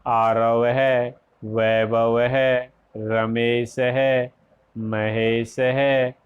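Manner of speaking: names ending है said "ehe", drawn out slowly; slow teacher demo of visarga on names. Shown as a demonstration. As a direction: say each name with a clear visarga echo "ehe". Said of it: "नाम के आगे विसर्ग लगा के उनका उच्चारण".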